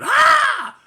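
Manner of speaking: loud high pained yell; hollered ah. Retold or imitated. Retelling the inmate's holler after a rib punch, loud high yell. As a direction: cry out like you took a rib shot. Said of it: "He hollered".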